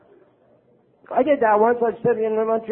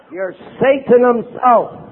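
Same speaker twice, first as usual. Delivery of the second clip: ordinary talk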